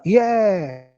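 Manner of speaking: "yeah" with a long drawn-out E; E estendido em yeah. Shown as a demonstration. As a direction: stretch the vowel of "yeah" slowly. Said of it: "Estende a fonética no E". ("yeah" com o E bem esticado)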